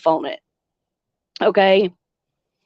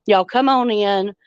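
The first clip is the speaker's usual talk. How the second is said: ordinary talk, nothing marked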